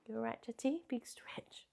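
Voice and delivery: hushed, gentle murmur to a pet; soft aside. Real, not acted. Vlogger breaks off to reassure a stretching pet, soft aside. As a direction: drop the voice right down, fading away.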